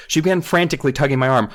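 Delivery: normal talking voice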